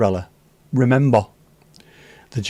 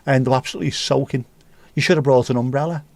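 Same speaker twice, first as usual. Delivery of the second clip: ordinary talk, nothing marked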